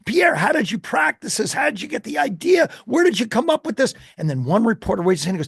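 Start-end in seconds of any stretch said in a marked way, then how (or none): 0.1-3.9 high, loud shouted questions, faster at the end; excited reporters shouting. Retold or imitated. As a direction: yell questions over the crowd. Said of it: "they're screaming".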